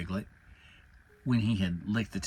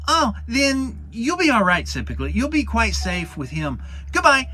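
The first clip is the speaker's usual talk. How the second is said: high, loud hasty farewell; squeaky Piglet eager to run home; relieved Piglet; 11 semitones higher, 8 dB louder than usual. Acted. Narrator voices Piglet.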